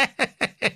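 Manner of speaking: high embarrassed giggle; king's giggle. Acted. (hohes verlegenes Kichern)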